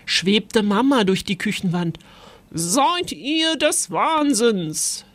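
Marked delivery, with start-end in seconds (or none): 2.5-5.0 höher und langsam, fassungslos empört; a mild shocked ghost mother, slow; fassungslose Mutter. Acted. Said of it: "starrte fassungslos". (fassungslos, langsam, höher)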